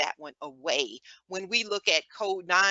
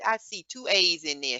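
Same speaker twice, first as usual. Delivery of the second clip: ordinary talk, nothing marked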